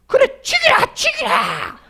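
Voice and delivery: high, loud screaming Busan wife; fierce Busan wife. Retold or imitated. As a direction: scream a dare at the husband. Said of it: "부산 여자들은 막 이게 대가 세가지고". (남편에게 대드는 부산 아내의 높은 외침)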